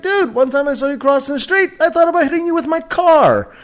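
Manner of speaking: very high-pitched retold comeback; mock-outraged banter. One-upping his friend about the street, high and mock-outraged. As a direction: top the insult in a high, heated squeal. Retelling his own topping insult.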